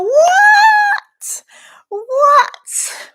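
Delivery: very high, loud disbelieving shriek; shocked shriek. Real, not acted. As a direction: shriek in total disbelief at the gift. Shocked by another tenner.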